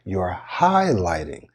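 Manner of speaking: "highlighting" stretched out and inflected; over-articulate "highlighting", slowly; extended, carefully articulated "highlighting"; emphasized HIGHLIGHTING. Shown as a demonstration. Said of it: "when I emphasized the word highlight".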